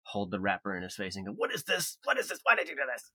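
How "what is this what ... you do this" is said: high, loud mock scolding of the dog; indignant parent scolding a dog. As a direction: hold up the wrapper and scold, pitched way up.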